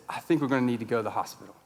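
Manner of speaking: low, soft, matter-of-fact calm; scary calm. Retold or imitated. Wife to panicking husband at the restaurant, scary calm. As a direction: keep it eerily calm and understated. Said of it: "in a very calm demeanor and tone".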